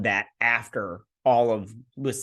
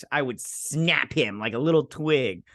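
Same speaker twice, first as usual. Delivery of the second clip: ordinary delivery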